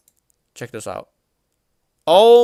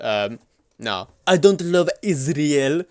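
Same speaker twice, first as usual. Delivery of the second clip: normal talking voice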